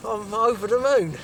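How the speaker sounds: loud and delighted; excited. Real, not acted. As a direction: boom it out, thrilled with the catch. Delighted angler speaking to the camera.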